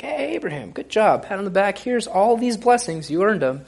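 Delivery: God patting Abraham on the back, mock-cheerful and high; cheery God voice. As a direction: play God handing out praise like a proud boss. Preacher voices God praising Abraham.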